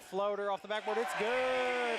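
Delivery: thrilled buzzer beater call; excited. Real, not acted.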